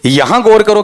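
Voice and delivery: ordinary talk, nothing marked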